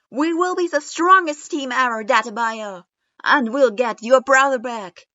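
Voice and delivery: high, loud battle cry; loud declaration. A narrator's reading. Naruto pumping his fist, vowing to his team, very loud and high. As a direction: roar it out with a fist in the air. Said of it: "agreed enthusiastically".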